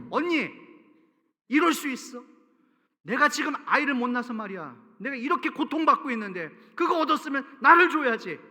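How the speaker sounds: loud, high, furious outburst at her sister; angry snapping. Acted. Rachel storming at her sister Leah, loud and furious. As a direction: spit it out in a jealous rage. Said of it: "성질에 나서 막 쏘아붙이는 거예요".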